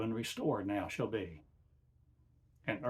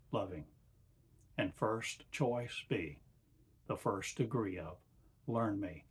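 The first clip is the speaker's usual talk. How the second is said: channeled divine voice. Acted. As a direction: speak as God through a man, measured and calm. Channeling God in slow sermon.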